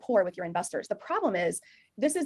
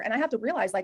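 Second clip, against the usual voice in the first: ordinary talk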